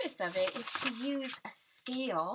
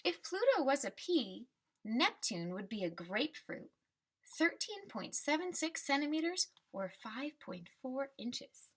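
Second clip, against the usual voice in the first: put-on reading to children; 3 semitones higher than usual. Teacher reading the class a picture book, storybook voice. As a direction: read the refrain like a picture book for kids.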